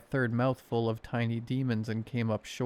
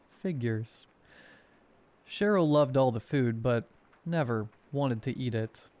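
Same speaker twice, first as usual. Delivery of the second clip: ordinary delivery, nothing marked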